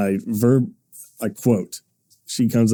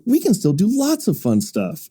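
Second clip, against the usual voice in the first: lightly voiced small child, pitched high; imitating a four-year-old comforting her dad; bright little girl reassuring her father, pitched high; little daughter voice; 9 semitones higher than usual. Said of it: "She goes".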